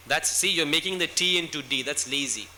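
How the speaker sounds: ordinary delivery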